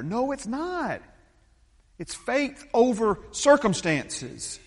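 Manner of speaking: high, slow, forceful preaching; emphatic rebuttal. Real, not acted. Pastor answering the mind over matter objection, emphatic. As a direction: drive each word home, loud and drawn out.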